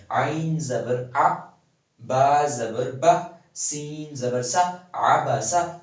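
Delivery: slow, firm drill, "آ", "با", "سا" each touched and released before "عبسہ"; short zabar vowels. Shown as a demonstration. Teacher drilling the correct short vowels for students. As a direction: spell "عبسہ" out slowly with every vowel cut short. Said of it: "صرف touch کر کے آپ نے پھر چھوڑنا ہے".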